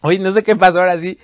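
loud giggly disbelief; can't stop giggling; breaking character giggling. Real, not acted. Giggling fit after coughing.

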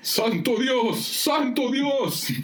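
high, stretched-out exclamation; hammed-up desperate cry; crying to heaven. Pierce cries out to heaven.

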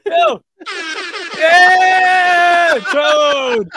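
loud, high whoops of triumph; excited cheering. Real, not acted. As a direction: cheer as the count drops below seven thousand. Cheering as count drops.

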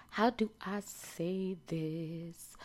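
slow, soft thinking aloud; musing aloud. Real, not acted. Podcaster asks herself how to word it, slow and soft. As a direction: draw it out softly while searching. Still searching for the right word.

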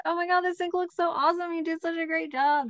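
high, gushing fake praise. Parent praises kid's clean sink, high fake gushing voice. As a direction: overdo the sweet praise, pitched high. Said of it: "don't use that fake voice".

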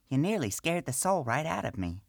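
high gnome voice, lightly scolding; scolding priestess. Acted. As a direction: tell him off for sneaking up. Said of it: "the priestess scolded".

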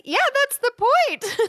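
very high-pitched retort; mock exclamation. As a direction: squeal the obvious answer in amused disbelief. Retells own reply to a naive question.

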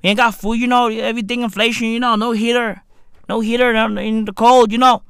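quick, monotone Merseyside grumble; mock Merseyside accent. Struggling Merseyside local listing hardships, mock Merseyside accent. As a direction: run it together in a put-on Merseyside accent. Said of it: "what accent is this?".

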